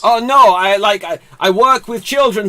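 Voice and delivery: a hammed-up, not-quite Israeli accent; bad Israeli accent. Host voicing Wonder Woman for guessers, weak Israeli accent. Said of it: "my best Israeli accent and it's not good".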